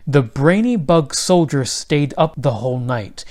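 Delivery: ordinary delivery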